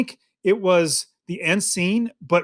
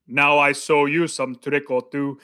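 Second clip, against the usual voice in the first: imitating Hossein; 3 semitones lower, slower than usual. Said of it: "That was brilliant".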